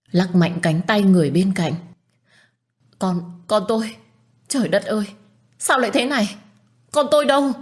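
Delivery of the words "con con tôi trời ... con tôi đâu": rising to a high, breaking wail. Acted. Mother grieving her lost baby to the doctor, high wail. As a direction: cry out for the lost child. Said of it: "Tôi cứ gào khóc ầm ĩ".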